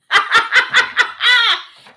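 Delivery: high, loud crying laugh. Real, not acted. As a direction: burst out laughing. Bursts out laughing mid-sermon.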